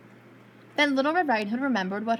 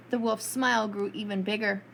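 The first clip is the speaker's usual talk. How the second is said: ordinary delivery, nothing marked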